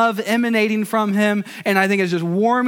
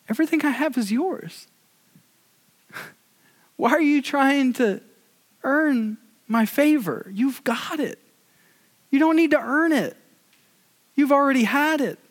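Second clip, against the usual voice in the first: tender father; 4 semitones higher than usual. Retold or imitated. Father repeats it to his son.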